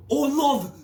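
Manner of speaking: very loud, high-pitched British holler; hammed-up British shout; British accent. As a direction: bellow it as an over-the-top Brit. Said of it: "I like a British accent".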